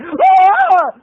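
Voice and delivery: high, loud yelp of fright; startled yell. Retold or imitated. Moviegoer startled by a scream behind, mock terrified yell. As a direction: imitate jumping out of your seat. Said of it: "this made me jump".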